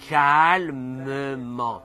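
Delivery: very slow, drawn-out calm word; calmly. Shown as a demonstration. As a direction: stretch each syllable, soothing.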